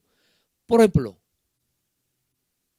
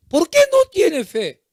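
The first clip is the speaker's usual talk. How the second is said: loud, high-pitched parental scolding; acted scolding, each question thrown louder and higher; blaming parent; 5 semitones higher, 5 dB louder than usual. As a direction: demand answers as an accusing parent. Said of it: "no echa culpa a sus hijos".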